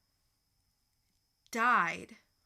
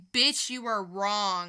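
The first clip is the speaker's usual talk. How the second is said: mock sassy comeback; 7 dB louder than usual. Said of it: "And I would say".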